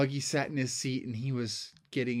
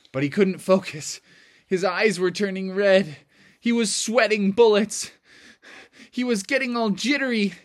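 rising, louder with each sentence; mounting tension; 6 semitones higher, 6 dB louder than usual. A narrator's reading. Narrator tells of Froggy losing focus, mounting agitation. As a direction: build the jitters toward an explosion.

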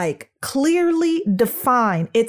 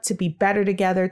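usual speaking voice

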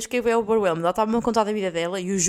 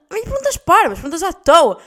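higher, loud punches on "parvas" and "à toa"; exasperated emphasis; 6 semitones higher, 9 dB louder than usual. Real, not acted. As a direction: hit "parvas" and "toa" hard. Exasperated by silly questions. (ênfase exasperada em "parvas" e "toa")

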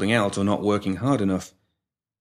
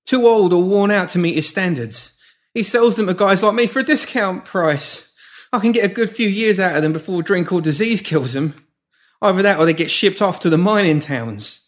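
frantic; 10 semitones higher, 5 dB louder than usual. A narrator's reading. Pimp confessing in fear.

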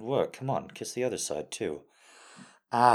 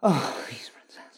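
whispering; 5 semitones higher, 7 dB louder, slower than usual. Acted. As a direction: breathe it out in a whisper. Whispered plea.

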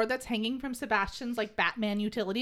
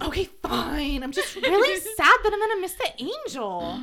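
whiny; 6 semitones higher, 4 dB louder than usual. Retold or imitated. Sebastian quoted whining.

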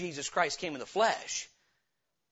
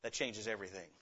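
much lower and softer, weighty; hushed emphasis; 10 semitones lower, 13 dB quieter than usual. Real, not acted. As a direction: drop the voice to let it sink in. Dramatic point after verse contrast.

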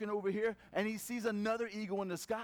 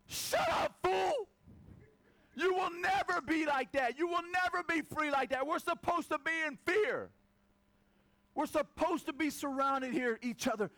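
angry chickens; 7 semitones higher than usual. Retold or imitated. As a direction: bark them down, scornful and shrill with alarm. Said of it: "the chickens are like".

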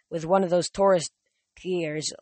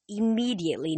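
plain reading voice

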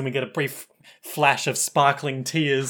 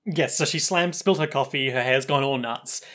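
usual speaking voice

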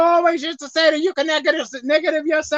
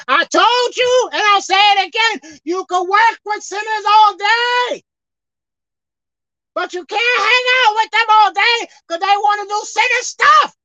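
loud, high preacher's cry, drawn out; full-force preaching; 7 semitones higher, 5 dB louder, slower than usual. Real, not acted. Preacher warning the congregation about hanging out with sinners, forceful preaching. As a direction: preach it hard with raised pitch.

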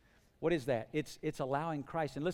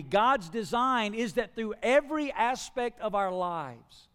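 louder, higher pulpit delivery with punched key words; impassioned preaching; 6 semitones higher, 8 dB louder, slower than usual. Real, not acted. Preacher telling the church they are God's instrument, impassioned. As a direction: drive each phrase home to the congregation.

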